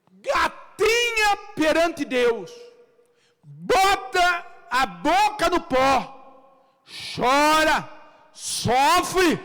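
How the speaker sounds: high, loud, slow exhortation; forceful preacher's shout with stretched words; preaching shout. Real, not acted. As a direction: cry out each command to the congregation.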